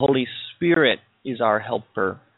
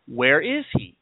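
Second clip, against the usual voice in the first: ordinary delivery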